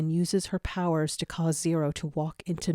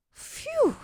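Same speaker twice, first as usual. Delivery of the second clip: relieved phew. A narrator's reading. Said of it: "sigh with relief".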